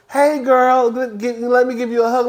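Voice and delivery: high, sugary fake-friendly greeting; mocking Wendy. Reviewer mocks Wendy greeting Giselle, high and syrupy. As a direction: gush it like a fake friend going in for a hug. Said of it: "Wendy walked up to Giselle and was like".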